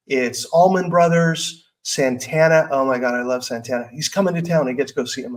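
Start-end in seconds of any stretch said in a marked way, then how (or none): none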